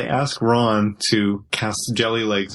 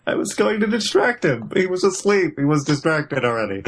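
defensive Hermione; 7 semitones higher than usual. Retold or imitated.

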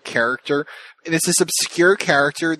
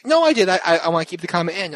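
insistent; 6 semitones higher than usual. Retold or imitated. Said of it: "She's like".

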